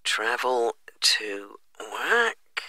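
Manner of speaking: slow whisper, noting it down; whispering to self. Real, not acted. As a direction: breathe it under your breath while writing. Agent repeats answer while typing.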